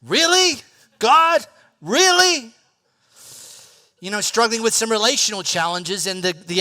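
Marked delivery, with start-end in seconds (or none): 0.0-2.5 high, loud incredulous cry; exasperated plea to God. Pretend. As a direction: complain up to heaven. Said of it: "you're like".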